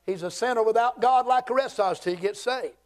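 ordinary talk

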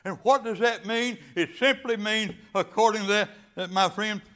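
loud, high, rolling preacher cadence; full-force preaching. Acted. Pastor preaching to his congregation, loud and fervent. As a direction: drive the scripture home to the congregation.

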